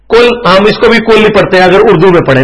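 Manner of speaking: ordinary delivery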